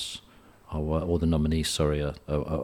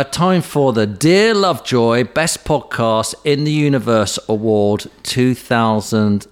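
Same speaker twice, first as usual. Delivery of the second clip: award announcer voice; 4 semitones higher, 6 dB louder, slower than usual. Pretend. Announcing mock awards ceremony.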